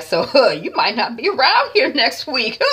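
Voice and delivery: high taunt broken by laughter; laughing. Retold or imitated. Vlogger acting Hadisa teasing T Y, laughing and high. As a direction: laugh through a gloating tease. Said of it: "with a big smile and kinda laughing".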